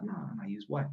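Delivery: hushed imitation of a whispered reply; retold whispering. Woman secretly answering electrician about her ex, retold in a hush. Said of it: "We're whispering".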